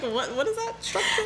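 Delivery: high, laughing mock confusion; laughing disbelief. Cohost laughing at the basketball net, high laughing disbelief. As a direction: squeal it through giggles, baffled by the net. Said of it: "I'm like".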